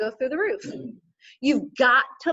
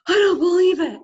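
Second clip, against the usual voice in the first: much higher and louder cry of disbelief; amazed exclamation; 8 semitones higher, 8 dB louder than usual. Retold or imitated. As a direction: burst out in astonishment. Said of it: "And Luke goes".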